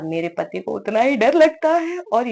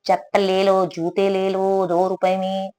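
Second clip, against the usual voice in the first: slow street seller's call; hawker call; slower than usual. Pretend. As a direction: call out like a bazaar vendor selling cheap slippers. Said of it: "ये ऐसी हालत है।". (फेरीवाले की तरह धीमी आवाज़ में पुकारना)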